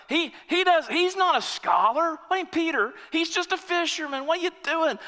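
high, indignant objection; mock a sneering scholar; a religious man scoffing at the fisherman pick; scoffing religious critic. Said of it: "He's like".

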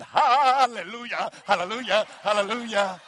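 hammed-up celebration of an empty nest; joyful hallelujah. Father rejoicing as the kids leave, loud mock hallelujah. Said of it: "I said".

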